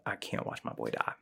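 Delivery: hushed, barely voiced inner thought; soft private confession under the breath; said to oneself. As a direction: murmur it softly to yourself. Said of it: "you can resonate to yourself".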